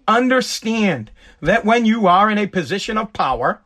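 usual speaking voice

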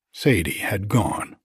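plain reading voice

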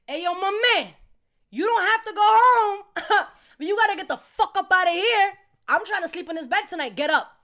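high, loud, laughing kick-out; loud mock eviction. Waking the guy to take his bed, loud and laughing. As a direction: boom in and roust him from bed. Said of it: "So I walk in".

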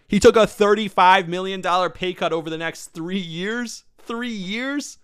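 high, incredulous stress on three years; amazed. Real, not acted. Host marvelling at the pay cut, rising incredulous. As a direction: let the disbelief climb on Three years.

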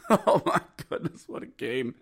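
soft, spent half-whisper after a laugh; hushed amazement. Real, not acted. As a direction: say it under your breath in wonder. Spent repeat after the win.

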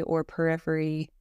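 measured Yoga Nidra narration, little pitch movement; guided meditation. Acted.

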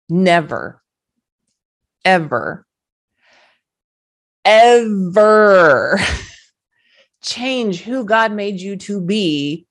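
loud, slow, breaking into sobs on ever; real tears with a loud slow cry. Real, not acted. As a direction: cry it out, stretching every ever. Tearful plea to listeners.

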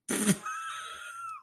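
very high burst of laughter; shrieking laugh. Real, not acted. Reading a Rolling Stone headline, a shrieking laugh.

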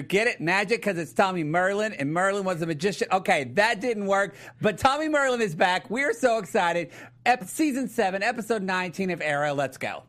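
ordinary delivery

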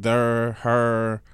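lightly voiced Saint Louis drawl; hint of St. Louis accent. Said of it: "that Saint Louis".

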